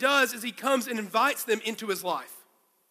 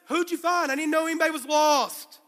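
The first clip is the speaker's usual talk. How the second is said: puzzled brother; 5 semitones higher than usual. Acted. Simon confused, joking.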